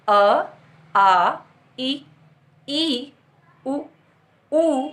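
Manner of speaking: each vowel held alone, with pauses between; slow vowel recitation; vowel drill. Shown as a demonstration. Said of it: "मात्राओं का बार बार उच्चारण करने से".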